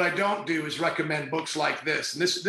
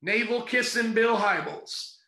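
usual speaking voice

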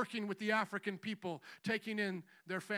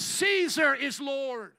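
shouted allegiance; 4 semitones higher, 10 dB louder, much slower than usual. Retold or imitated. Roman allegiance chant.

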